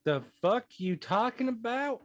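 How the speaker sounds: high, loud disbelief; incredulous outburst. Acted. Prisoner hearing twenty years passed, incredulous and high. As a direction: demand an answer in shock.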